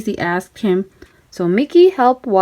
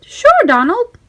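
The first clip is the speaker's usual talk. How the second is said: high eager mouse voice; high Mickey voice; 10 semitones higher, 3 dB louder than usual. A narrator's reading. Mickey agreeing to help Donald, high and eager. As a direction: agree brightly and gladly.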